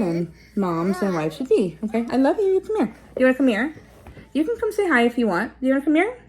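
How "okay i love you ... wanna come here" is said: higher, coaxing the little one over. Real, not acted. Mom calls her toddler over to the camera, high and coaxing.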